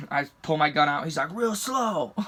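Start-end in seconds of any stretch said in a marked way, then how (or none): 1.3-2.1 higher-pitched imitation of a cop; mock police command in a raised pitch; cop's order. Cop directing an armed driver, imitated command.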